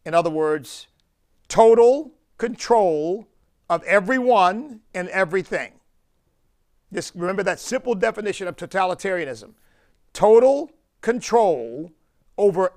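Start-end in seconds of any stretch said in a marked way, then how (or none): 1.5-3.3 total control, higher, louder and slowed, total ringing out; emphatic total. Acted. Preacher drilling the definition into listeners, ringing emphatic stress. 10.1-11.9 total control, higher, louder and slowed, total ringing out; emphatic total. Acted. Preacher drilling the definition into listeners, ringing emphatic stress.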